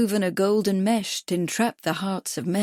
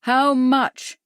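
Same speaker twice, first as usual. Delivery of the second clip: loud, higher question; shouted; 5 semitones higher, 5 dB louder than usual. A narrator's reading. Bradford to the deaf doctor, a loud shout. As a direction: lean in and shout it to a deaf man. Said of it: "He leaned closer and shouted".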